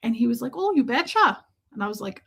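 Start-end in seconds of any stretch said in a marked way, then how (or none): 0.6-1.4 high, put-on Canadian "you betcha" lilt; mock folksy Canadian accent; Fargo-style Canadian accent. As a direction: imitate a Fargo accent, pitched up. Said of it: "somebody else's accent".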